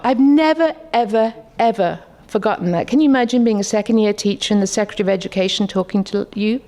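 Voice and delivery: ordinary talk, nothing marked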